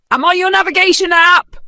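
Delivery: very high, loud sarcastic jeer; mocking outrage. A narrator's reading. Vanessa mocking the lost old woman, loud and sarcastic. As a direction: ridicule her request.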